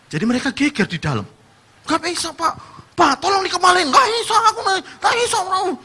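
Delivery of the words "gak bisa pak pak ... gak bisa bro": high-pitched squabbling back and forth; mock a heated high argument; quarrelling customers. Two customers arguing over goods, high and squabbling.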